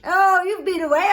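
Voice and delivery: high, loud put-on greeting; nosy rich brother. Rich brother greeting the returning poor man, nosy. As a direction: pipe up with exaggerated interest.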